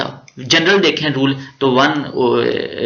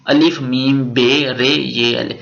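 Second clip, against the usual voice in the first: slow spelling aloud; slower than usual. Shown as a demonstration. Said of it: "इसको उर्दू में लिखें".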